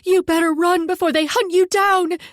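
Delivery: high, loud threatening yell at the attackers; defiant scream. A narrator's reading. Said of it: "Kara screamed".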